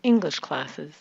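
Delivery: "English" stressed, "classes" low with a reduced ending. Shown as a demonstration. Coach contrasts with student's clip, stress on "English". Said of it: "dropping our voice on the second word".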